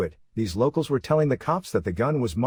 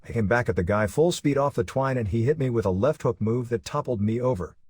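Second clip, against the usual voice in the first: ordinary delivery, nothing marked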